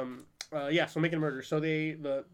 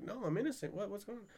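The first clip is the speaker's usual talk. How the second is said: higher, much softer bewildered voice; mock-innocent confusion, pitched up and quiet; bewildered protest; 5 semitones higher, 7 dB quieter than usual. Voices bewildered convicted man's plea.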